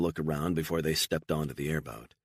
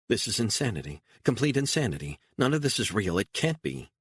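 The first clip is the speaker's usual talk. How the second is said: panicked; 6 semitones higher than usual. Acted. Beatrice panics after dumping body.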